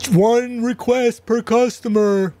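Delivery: high, slow, drawn-out holler; distant yelling. Retold or imitated. John Lightning yelling across the room to a caller, mock holler. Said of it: "he would be yelling from across the room".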